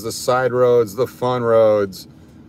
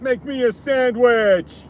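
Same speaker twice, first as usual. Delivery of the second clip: calling out; 11 semitones higher, slower than usual. Shown as a demonstration. Calling out to Karen.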